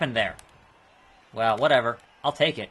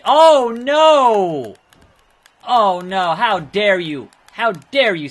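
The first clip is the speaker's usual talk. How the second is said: high, loud hammed-up indignation; mock outrage; 5 semitones higher, 10 dB louder, much slower than usual. Gamer mocks the opponent's dirty move with outrage. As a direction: play the scandalised victim.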